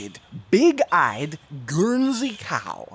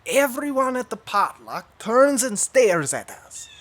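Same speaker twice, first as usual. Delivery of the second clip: usual speaking voice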